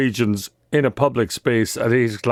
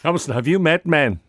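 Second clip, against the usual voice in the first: ordinary talk, nothing marked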